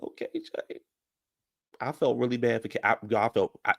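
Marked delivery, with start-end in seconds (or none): 0.0-0.8 high, breathy whisper of sympathy; pitying whisper. Retold or imitated. Seeing the hurt friend walk past, a pitying whisper. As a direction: whisper it like you feel sorry for him. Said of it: "I was just like".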